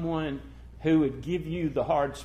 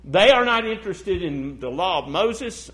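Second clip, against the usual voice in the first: usual speaking voice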